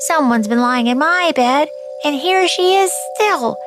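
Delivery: high, rising squeak of a cub; little wee bear voice. A narrator's reading. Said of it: "in his little wee voice".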